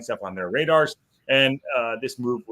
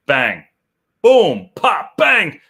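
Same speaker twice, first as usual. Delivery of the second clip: loud, higher punchy bursts; hype sound words; 6 semitones higher, 11 dB louder, much slower than usual. Real, not acted. Host hyping the article going on screen, loud bursts. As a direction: fire each word off like a drumroll hit.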